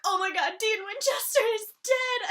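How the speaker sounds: high, loud fangirl squeal; starstruck reaper. Retold or imitated. The Reaper recognising Dean, squealing excitedly. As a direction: gush like a starstruck fan. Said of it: "she's like".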